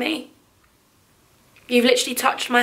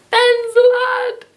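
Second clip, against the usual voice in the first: squealed much higher and louder, drawn out; delighted squeal; 11 semitones higher, 4 dB louder, much slower than usual. Real, not acted. Seeing her brother named on a card, she squeals his name.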